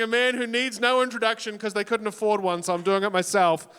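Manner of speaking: ordinary delivery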